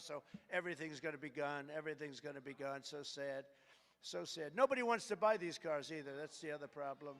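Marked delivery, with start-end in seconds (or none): 0.0-4.6 softer, lower mock sorrow; hammed-up sad murmur; mock lament. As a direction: sigh it like a fake eulogy. Mock lament over electric cars.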